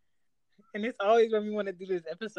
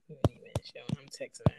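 whispered aside; breathy whisper under the call; 5 semitones lower, 6 dB quieter, much faster than usual. Real, not acted.

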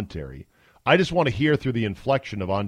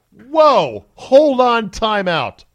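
much higher and louder, a burst of mock astonishment; stunned whoa; 11 semitones higher, 8 dB louder, much slower than usual. Real, not acted. Host cutting in on Cantor's quote with a loud astonished whoa. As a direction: pull up short like you just heard something wild.

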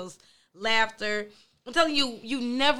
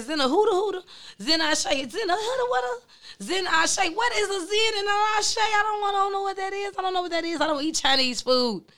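confused disbelief; 10 semitones higher than usual. Retold or imitated. Lady K replaying her baffled reaction on the phone, high mock outburst. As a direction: act totally baffled by the strange name. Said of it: "I said".

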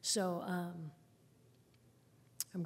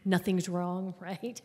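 ordinary talk

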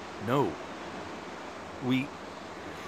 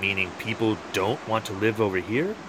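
ordinary talk, nothing marked

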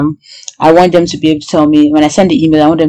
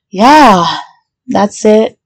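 usual speaking voice

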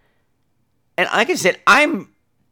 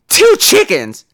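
playing the outraged thief, hammed up; woman at checkout fakes outrage over two chickens, loud and indignant; indignant outburst; 2 semitones higher, 4 dB louder than usual.

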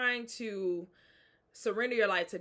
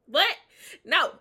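usual speaking voice

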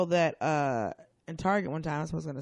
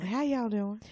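higher-pitched Southern greeting; imitated Southern accent; 5 semitones higher, slower than usual. Guest repeats his greeting, Southern accent. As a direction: chirp a mock Southern hello. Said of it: "Oh, the South".

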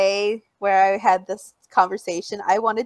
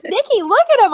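high, loud gushing fan; excited fan voice; 11 semitones higher, 5 dB louder than usual. Retold or imitated. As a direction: squeal it like an eager fan. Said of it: "They're like".

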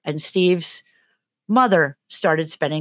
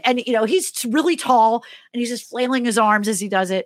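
ordinary talk, nothing marked

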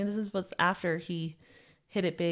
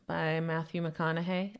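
ordinary talk, nothing marked

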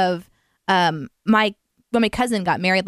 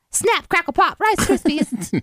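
jokey high voice; 10 semitones higher than usual. Pretend. Jokey answer.

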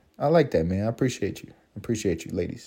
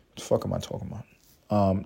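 ordinary talk, nothing marked

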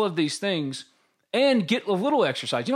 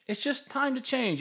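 higher softer echo; 8 semitones higher, 6 dB quieter than usual. Shown as a demonstration. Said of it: "repeats the phrase for emphasis".

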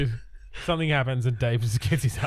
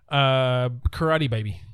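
normal talking voice

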